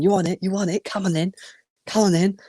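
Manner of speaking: imitated challenge, pitched well up; squaring-up dads. Rival dads squaring up for a fight, retold high-pitched goading. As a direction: goad them in a high mock-aggressive voice. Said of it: "the opposition's dads, like, saying".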